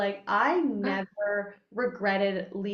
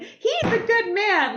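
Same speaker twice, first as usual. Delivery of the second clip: high, loud, drawn-out mom wail; mock protesting mother; 11 semitones higher, 8 dB louder, slower than usual. Daughter mimics mom defending the husband, overdone protest. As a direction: stretch it out in exaggerated maternal protest.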